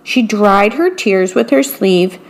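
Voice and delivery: plain reading voice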